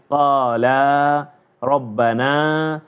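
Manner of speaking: Qala rabbana with long a vowels, slow; slow qaa-laa rabbanaa; long alif. Shown as a demonstration. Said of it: "the example will be like this".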